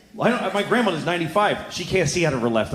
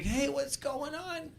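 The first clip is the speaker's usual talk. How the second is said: high, slow, soft strained greeting; mock cheer through pain; happy but pained; 11 semitones higher, 14 dB quieter, much slower than usual. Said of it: "they sound like they're in pain also".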